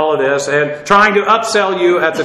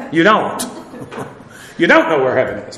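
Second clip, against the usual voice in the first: ordinary talk, nothing marked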